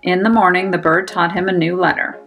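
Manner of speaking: plain reading voice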